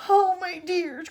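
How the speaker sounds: high, loud, slow wail; anguished cry. A narrator's reading. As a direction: wail it out in alarm. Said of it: "cried Grandma Georgina".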